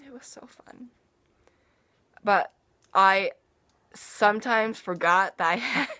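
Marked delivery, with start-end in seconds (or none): 0.1-0.9 very soft whisper; hushed delight. Real, not acted. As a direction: whisper it as a gleeful aside. Savoring the memory aside.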